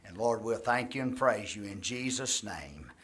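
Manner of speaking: earnest pastor's prayer, steady and sincere; closing prayer. Acted. Pastor praying over the congregation, earnest and steady. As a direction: pray it aloud for the whole room.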